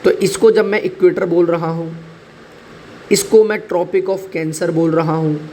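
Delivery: ordinary talk, nothing marked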